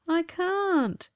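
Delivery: high, thin plaintive ghost; a weak ghost pleading he is unable. Acted. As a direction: whine it like a feeble ghost. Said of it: "his thin voice".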